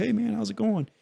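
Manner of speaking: high, easygoing hey man from the coach; lightly voiced cheerful coach dropping by; friendly coach greeting. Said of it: "and be like".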